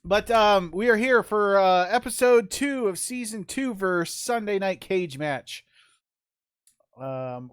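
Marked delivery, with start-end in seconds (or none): 0.0-5.7 big, high ring announcer intro. Acted. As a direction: boom it out like an arena host. Announces the episode.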